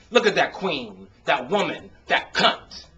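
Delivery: put-on loud, scornful sneer at another boy; mocking disgust. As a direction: spit it out with contempt. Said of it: "we shake our heads in disgust".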